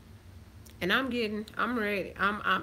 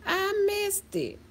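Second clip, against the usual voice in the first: imitating latecomer; 11 semitones higher than usual. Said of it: "then be like".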